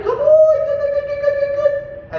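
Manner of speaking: very high, rapid "good, good, good" to hype up a dog; squeaky, bubbly praise that revs the dog up; excited high praise. Shown as a demonstration. Trainer revving up the dog with high excited praise. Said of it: "a little bit higher pitched, a little more excited".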